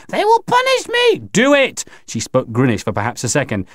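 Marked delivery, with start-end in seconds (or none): 0.1-1.2 high, frantic alien cry; very high-pitched dread; panicked protest. Acted. Alien aunt dreads punishment. 1.3-1.9 high, urgent order; insistent raised-pitch order; forceful command. Acted. Narrator orders the alien.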